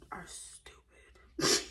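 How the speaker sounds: slow whispered verdict; slowly whispering a cheeky punchline to viewers. Real, not acted.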